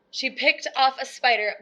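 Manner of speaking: usual speaking voice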